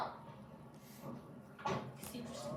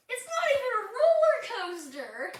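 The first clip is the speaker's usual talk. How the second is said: high indignant protest; 9 semitones higher, 7 dB louder, slower than usual. Real, not acted. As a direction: protest loudly. Indignant complaint.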